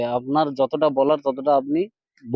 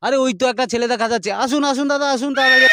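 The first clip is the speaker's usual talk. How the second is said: excited calling out; 7 semitones higher, faster than usual. Acted. Reporter calls boy over.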